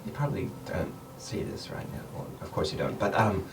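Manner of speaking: hushed, breathy warning to the group; whisper. Acted. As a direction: keep it to a whisper, uneasy. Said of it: "Celine speaks up".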